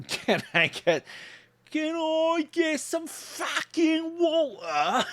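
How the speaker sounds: very high, slow overdone American accent; exaggerated American accent. Shown as a demonstration. Mocking Americans asking for water, exaggerated American accent. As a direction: stretch every word, full mock American. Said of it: "I'm exaggerating it big time".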